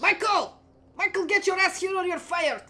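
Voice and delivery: yelling chef. Acted. Head chef yelling for his kitchen boy, loud and angry. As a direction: summon the boy with a furious yell. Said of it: "he looks around and goes like".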